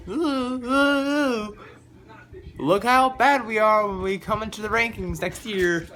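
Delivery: high mocking sing-song voice of smug fans; mocking champions. Fan mocking the champs' boasting, high mocking voice. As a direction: sneer at the champs in a high mock voice. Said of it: "They're like".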